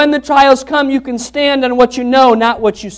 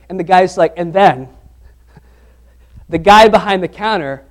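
normal talking voice

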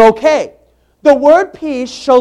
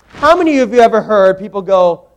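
ordinary delivery

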